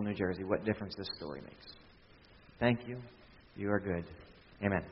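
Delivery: soft, hushed prayer; softly spoken prayer; quiet prayer. Real, not acted. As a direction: pray gently and quietly.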